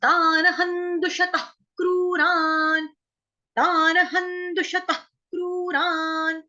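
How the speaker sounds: दुषतः with a faint kh breath at the end; slow chant, just a touch of kh on दुषतः; light kh touch. Shown as a demonstration. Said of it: "हल्का सा touch देना है".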